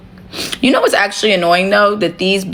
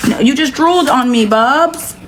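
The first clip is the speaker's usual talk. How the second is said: pet talk; 6 semitones higher than usual. Real, not acted. Talking to her pet.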